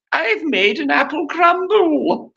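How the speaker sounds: put-on high, drawn-out posh cookery-school girl; exaggerated high, slow posh voice. Mocking a posh cookery student showing off her crumble, high hammed-up posh voice.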